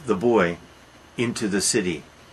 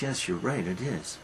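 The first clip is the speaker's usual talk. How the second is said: softer, dawning horror; hushed; 9 dB quieter than usual. Retold or imitated. Mother quietly admits to grandfather what she holds, hushed. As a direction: let it sink in quietly.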